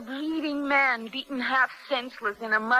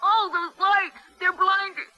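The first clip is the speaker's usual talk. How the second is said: high, pained cries against glaring lights; 6 semitones higher than usual. Acted. Prisoner cries out at the blinding lights in pain. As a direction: cry out as the light hurts.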